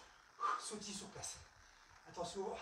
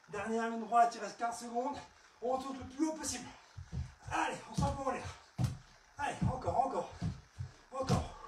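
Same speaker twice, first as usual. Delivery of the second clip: coach qui crie; 3 semitones higher, 7 dB louder than usual. Real, not acted. Coach pushes final seconds.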